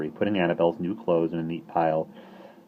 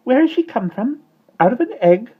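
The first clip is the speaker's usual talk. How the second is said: very high, loud chick cheep; 9 dB louder than usual. Acted. Fledgling suddenly cheeping a question, very high and loud. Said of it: "cheeped the fledgling suddenly".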